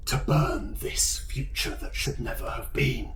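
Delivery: a dark secret breathed into the mind; whispering demon voice. Acted. Demon speaks again in mind.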